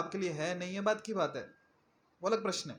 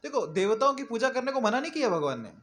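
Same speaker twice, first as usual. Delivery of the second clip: usual speaking voice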